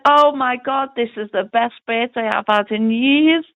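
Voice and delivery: high-pitched, thrilled birthday gasp, re-enacted; retold delight. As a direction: imitate an overjoyed brother's exclamation. Retelling brother's delighted reaction.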